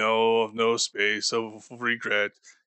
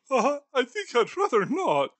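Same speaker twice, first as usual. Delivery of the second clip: put-on higher, frail old miser on the edge of tears; a scared old man half-crying at a ghost; whimpering Scrooge.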